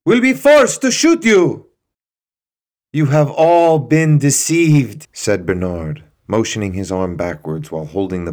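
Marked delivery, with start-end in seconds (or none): 0.1-1.7 high, very loud final warning; threatening shout. Acted. Guard threatens to shoot. 2.9-5.1 slow, loud, raised revelation; solemn declaration. Acted. Bernard declares to the crowd.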